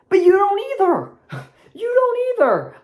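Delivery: high, loud taunt with a laugh; scornful taunt. Real, not acted. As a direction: jab at them, laughing. Mocking Chiefs fans.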